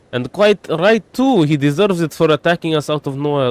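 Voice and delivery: high-pitched Paimon voice with a put-on accent. Paimon indignant at the attacker, high voice in a put-on accent. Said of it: "why am I doing that accent for Paimon".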